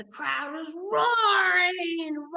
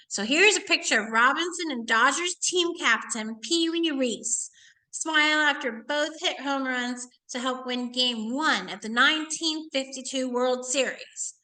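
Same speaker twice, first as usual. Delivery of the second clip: ordinary talk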